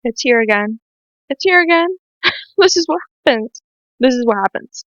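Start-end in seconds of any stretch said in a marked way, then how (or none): 1.3-2.3 high, tearful voice; about to cry. Real, not acted. Vlogger feels the tears return, about to cry. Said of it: "I'm gonna cry again". 2.6-3.6 high voice, laughing through tears; tearful laugh. Real, not acted. Vlogger explains her returning tears, tearful laugh. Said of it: "I'm gonna cry again".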